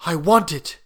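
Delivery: ordinary delivery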